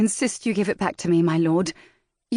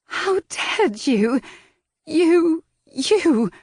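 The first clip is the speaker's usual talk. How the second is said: sputtering, louder, higher; furious outburst; 12 semitones higher, 5 dB louder, much slower than usual. Acted. Valentine sputters at the rake, louder and higher. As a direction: she chokes on rage at him.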